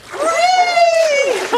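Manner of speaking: loud shouted count; excited shout. Real, not acted. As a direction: yell the count to launch. Count ends with throw shout.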